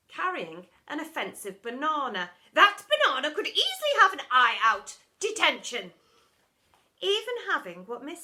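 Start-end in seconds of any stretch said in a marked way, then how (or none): 2.5-5.9 very loud condemnation; outraged teacher. Acted. Miss Seeth confiscating banana, outraged. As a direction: treat the banana as a weapon.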